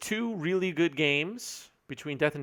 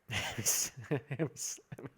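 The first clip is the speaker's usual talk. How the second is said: slow, breaking into laughter; can't get the name out for laughing; 7 dB quieter, much slower than usual. Real, not acted. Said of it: "Why is that so funny?".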